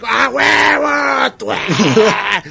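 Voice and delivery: loud fierce war cry; battle cry shout. Retold or imitated. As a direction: yell it like a warrior slicing down. Acted battle cry of Trunks.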